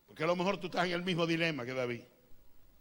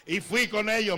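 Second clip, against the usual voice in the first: ordinary talk, nothing marked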